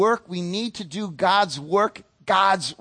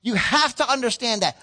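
ordinary delivery

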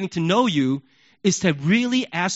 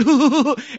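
short amused laugh; 7 semitones higher, 5 dB louder than usual. Real, not acted. Speaker laughing at his kid impression. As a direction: laugh at your own bit.

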